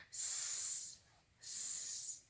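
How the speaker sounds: voiceless "S" hiss, soft; snake hiss. Shown as a demonstration. Teacher as snake hissing the "S" sound. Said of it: "Sammy Snake slithers on by and says".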